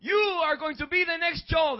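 booming impression of the boss; imitating the boss. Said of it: "This is how he talks".

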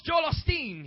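booming impression of the boss; imitating the boss. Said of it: "This is how he talks".

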